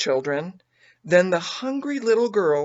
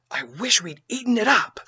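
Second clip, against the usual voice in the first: selfish thought; 5 semitones higher than usual. A narrator's reading. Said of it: "thought I".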